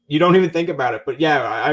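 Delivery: ordinary talk, nothing marked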